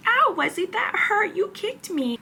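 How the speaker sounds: put-on high, whiny little girl's "ow"; imitating dramatic toddler. Mother imitating Maisie complaining to Wesley, high and whiny. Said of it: "Maisie is pretty dramatic".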